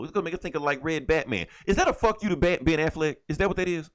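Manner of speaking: normal talking voice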